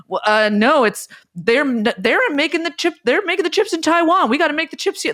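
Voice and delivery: high-pitched, flustered mock protest; excitable high-pitched caricature; Donald Trump. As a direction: sputter and stumble, voice climbing high. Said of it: "then Trump being like".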